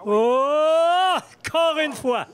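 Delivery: loud, high cry of amazement, slow at the end; excited exclamation. Real, not acted. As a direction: marvel at it out loud. Marvelling at another birdie.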